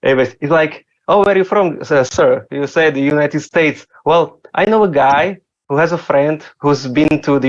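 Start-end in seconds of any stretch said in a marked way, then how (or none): none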